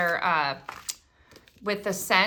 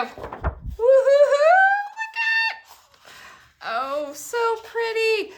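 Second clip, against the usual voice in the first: much higher, slow, louder whooping delight; gleeful whoop; 5 dB louder, much slower than usual. Real, not acted. Revealing the last card to viewers, high gleeful whoop. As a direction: squeal it out like a reveal.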